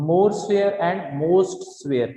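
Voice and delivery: very slow and drawn out; slow dictation. Shown as a demonstration. Teacher dictating the forms slowly to students. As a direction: read it out slowly so everyone can write it. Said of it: "तो इसमें हम क्या बोलेंगे".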